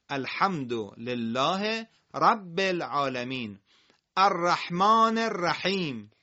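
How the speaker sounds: slow, measured recitation; Quran recitation. Shown as a demonstration. Teacher reciting verses for pupils, slow measured recitation. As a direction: recite slowly, stretching the long vowels.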